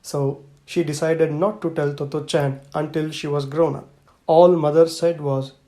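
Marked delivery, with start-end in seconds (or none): none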